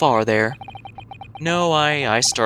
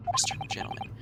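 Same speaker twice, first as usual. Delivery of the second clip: whispered under the breath, "Western gentleman" repeated softly; a whispered aside echoing the sailor; 7 dB quieter than usual. Acted. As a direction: mutter it back to yourself.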